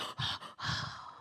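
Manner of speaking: imitated wounded gasp; shocked gasp. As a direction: gasp as if deeply hurt, hammed-up. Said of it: "He was heartbroken".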